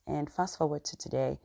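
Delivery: ordinary delivery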